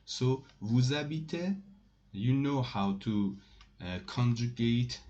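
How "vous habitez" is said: "Vous habitez" swept upward to the last syllable; rising question intonation. Shown as a demonstration. French teacher repeats the example for learners, rising question intonation. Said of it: "you will make your voice up".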